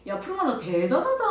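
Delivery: high, loud, drawn-out gushing praise; imitating admiring agreement. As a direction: chime in as an impressed fellow teacher. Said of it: "우리 선생님이 맞장구 쳐주거든요". (맞장구치며 크게 칭찬하는 말투)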